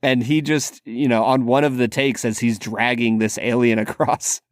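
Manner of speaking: ordinary talk, nothing marked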